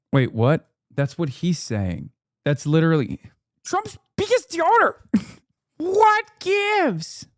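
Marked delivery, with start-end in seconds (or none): 3.6-5.0 lightly mocking high-pitched reading of Trump's biggest donor. 5.8-7.3 high, drawn-out mock whine on What gives, stressed What; mock indignation. Mocking the tweet's question.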